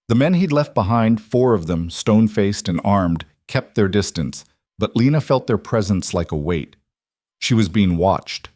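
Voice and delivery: ordinary delivery, nothing marked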